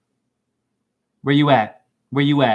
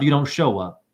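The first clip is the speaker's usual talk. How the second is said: usual speaking voice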